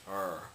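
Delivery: r held smooth, without trilling; English r. Shown as a demonstration. Showing the upside-down symbol's sound, smooth English r. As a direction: sustain an untrilled English r. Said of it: "That's how you say English".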